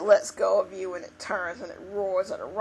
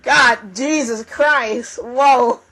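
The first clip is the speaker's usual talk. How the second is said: loud, high, drawn-out shock; real startled yelp of words; startled outburst; 5 semitones higher, 11 dB louder, much slower than usual. Real, not acted. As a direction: exclaim loudly and slowly in real alarm. Startled outburst after the loud laugh.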